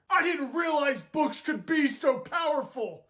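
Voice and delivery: ordinary talk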